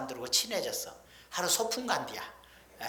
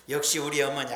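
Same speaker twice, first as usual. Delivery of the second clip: ordinary talk, nothing marked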